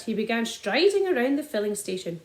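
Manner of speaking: usual speaking voice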